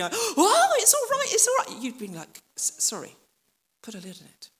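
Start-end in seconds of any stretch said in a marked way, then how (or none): 0.0-1.8 much higher and louder excited yell; high, loud, breathless cry of joy; bursting in excited. Retold or imitated. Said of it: "somebody comes bursting in going". 2.6-4.5 hushed, annoyed whisper; grumpy whispered brush-off; irritated shushing whisper. Retold or imitated. Grumpy hiding men shushing.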